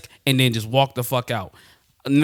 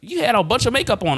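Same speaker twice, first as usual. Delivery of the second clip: mom's objection; 10 semitones higher than usual. Retold or imitated. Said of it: "she'd be like".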